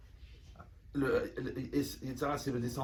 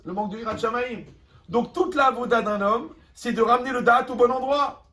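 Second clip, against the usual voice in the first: loud, driving sermon voice; preaching at full force; 6 semitones higher, 7 dB louder than usual. Acted. Rabbi pressing his listeners on anger, preaching loudly. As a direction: hammer the lesson home to the crowd.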